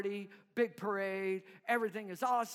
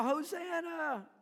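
higher, slow drawn-out cheer; crowd cheering; 7 semitones higher, slower than usual. Retold or imitated. Crowd hailing the deliverer, raised and slow. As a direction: stretch it like a hopeful crowd.

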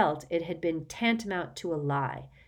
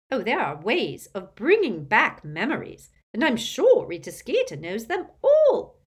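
put-on gossipy old aunt; 5 semitones higher, 8 dB louder than usual. Muriel voiced loud.

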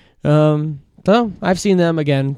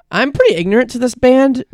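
ordinary talk, nothing marked

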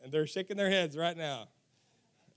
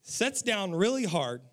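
ordinary delivery

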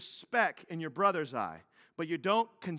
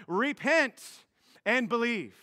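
slow, louder, proclaiming the command; proclaimed command; 5 semitones higher, 5 dB louder, slower than usual. Shown as a demonstration. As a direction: announce the command to the crowd. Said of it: "his first commands".

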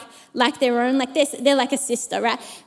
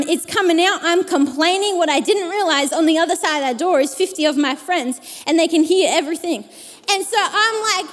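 usual speaking voice

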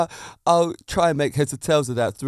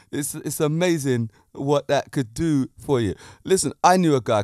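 ordinary talk

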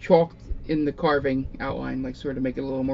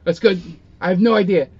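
loud, high, rushed orders; flustered NPC; 4 semitones higher, 6 dB louder than usual. Acted. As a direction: bark orders in a panic. GM voices NPC giving orders.

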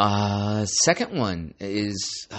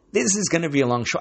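excited; 9 semitones higher, 5 dB louder, much faster than usual. Real, not acted. Hyped show opening.

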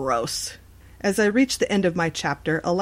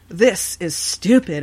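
annoyed at herself; 5 dB louder, slower than usual. A narrator's reading. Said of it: "I said to myself".

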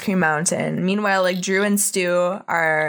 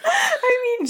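laughing. Real, not acted. Laughing reaction.

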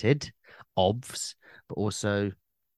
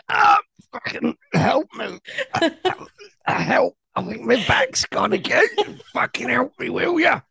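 pained cry for help; 4 dB louder than usual. Pretend. Stuck after a failed forward roll.

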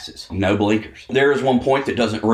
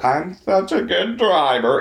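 mock goody-goody; 5 semitones higher than usual. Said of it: "You're all like a little angel".

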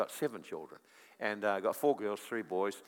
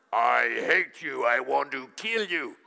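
mock demonic man's voice; 8 dB louder than usual. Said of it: "spoke to me in a man's voice".

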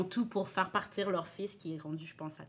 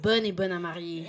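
ordinary delivery

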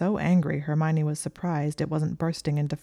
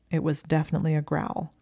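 ordinary delivery

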